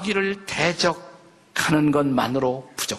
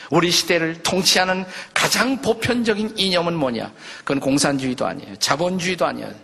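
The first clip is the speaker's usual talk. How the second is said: ordinary delivery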